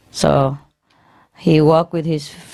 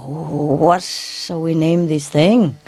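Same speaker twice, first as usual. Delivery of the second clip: drawn out, very slow puzzled question; much slower than usual. Retold or imitated. Adam asking Eve about the elephant, slow and puzzled. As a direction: stretch it out, wondering.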